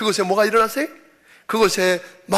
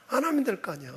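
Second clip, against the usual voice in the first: soft refusal, falling away at the end; resigned refusal; 2 semitones higher, 9 dB quieter, faster than usual. Acted. Church member choosing the easy way out, soft resigned refusal. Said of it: "뭐라고 그래요".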